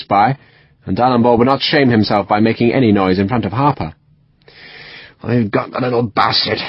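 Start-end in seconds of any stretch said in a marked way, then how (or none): 5.2-6.6 mumbled West Country surgeon past a cigar. A narrator's reading. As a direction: mutter it round a cigar. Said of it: "mumbled past the wet cigar stub".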